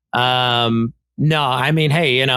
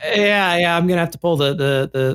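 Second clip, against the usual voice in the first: normal talking voice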